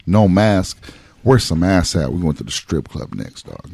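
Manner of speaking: normal talking voice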